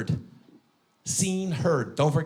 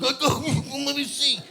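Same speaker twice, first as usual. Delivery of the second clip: mock kite guy; 14 semitones higher, much slower than usual. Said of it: "the guy who's like".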